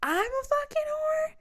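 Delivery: high, slow, outraged repeat; outraged shriek. Retold or imitated. Throwing his word back at him, outraged shriek.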